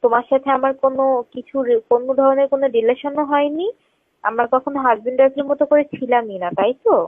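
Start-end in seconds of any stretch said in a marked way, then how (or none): none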